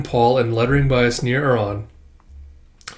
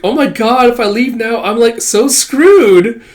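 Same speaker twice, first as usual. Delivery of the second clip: put-on valley girl; 9 semitones higher, 6 dB louder, slower than usual. Shown as a demonstration. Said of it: "Would it be more like this?".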